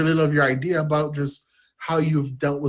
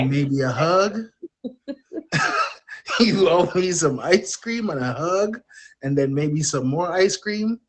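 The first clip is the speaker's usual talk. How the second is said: laughing; 4 semitones higher than usual. Real, not acted. Guest laughing while telling Trevor he owes her ice cream. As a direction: repeat it through a laugh, a bit higher. Repeats demand laughing at host.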